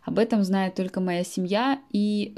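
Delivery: normal talking voice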